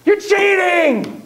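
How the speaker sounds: put-on high, slow, indignant child's cry; mock accusation. A kid protesting a game rule, high indignant cry. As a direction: whine it out like a kid catching a cheater.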